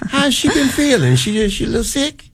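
put-on high, cracked old lady voice; old woman voice. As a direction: ask it like a frail old woman. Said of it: "you're doing the old woman voice".

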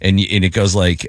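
normal talking voice

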